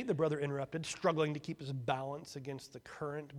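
ordinary delivery

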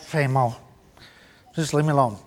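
a grumbling squirrel who wants peace; weary squirrel. Acted. Squirrel voiced brushing Rabbit off.

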